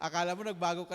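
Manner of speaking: fast, loud, laughing through it. Real, not acted. Preacher laughing at the story to congregation, fast. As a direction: chuckle the words out.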